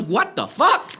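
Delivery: mock-aghast outburst; shocked disbelief. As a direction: blurt it as an appalled auntie. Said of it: "describe her expression was".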